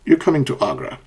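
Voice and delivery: "You're coming to Agra." falling away on "Agra"; falling intonation. Shown as a demonstration. Teacher demonstrating the second sentence, falling intonation. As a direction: close the sentence downward at "Agra". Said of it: "asked with a falling intonation".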